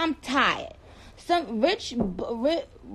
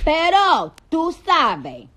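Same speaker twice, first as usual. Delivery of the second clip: put-on Spanish accent; 4 semitones higher, 7 dB louder, much slower than usual. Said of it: "I can do that accent".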